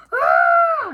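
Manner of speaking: very high, loud yelp of shock; startled cry. Acted. Grandpa crying out as he is whacked. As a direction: jolt awake with a yell.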